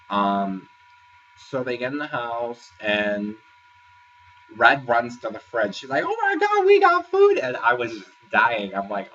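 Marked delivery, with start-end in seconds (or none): 6.1-7.4 high, loud thrilled squeal; excited squeal. Retold or imitated. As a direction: squeal with delight at the fridge. Red excited at fridge.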